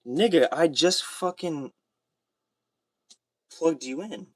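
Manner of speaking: irritated at the phone for complaining; annoyed retort. Real, not acted.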